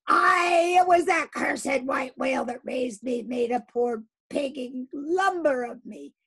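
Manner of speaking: high, loud raging shout; furious outburst. Acted. Captain rages to his crew about the whale. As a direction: rail at the whale.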